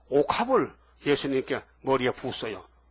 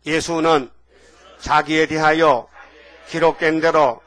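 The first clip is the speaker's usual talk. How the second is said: 구절 따라 외치기; 5 dB louder, slower than usual. Shown as a demonstration. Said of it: "따라합시다".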